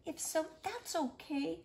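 ordinary delivery, nothing marked

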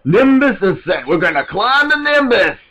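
high, loud excited shout; hyped-up. Real, not acted. Gamer announces climbing the Nimbus, hyped-up. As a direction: cry it out like a battle call.

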